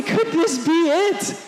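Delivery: high, slow, thrilled crowd voice; excited hope. Retold or imitated. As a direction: ask it breathless with hope. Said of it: "they're saying".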